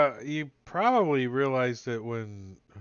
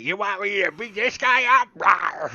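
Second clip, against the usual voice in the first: high loud eager creature voice; eager pet voice; 8 semitones higher, 12 dB louder than usual. Retold or imitated. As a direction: bark it out, raring to fight. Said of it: "He's like".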